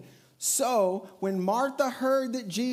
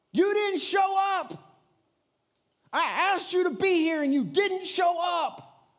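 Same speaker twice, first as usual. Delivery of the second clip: high, loud hurt yell; angry shout; 10 semitones higher, 5 dB louder than usual. Retold or imitated. Martha shouting at Jesus for not coming, furious. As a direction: shout the blame at him, voice raised. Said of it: "She's mad".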